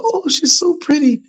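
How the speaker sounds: very high, sugary mock praise; fake compliment. Neighbor faking praise for the baby, sugary and high. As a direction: say it sweet but hollow. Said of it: "she looked and she said".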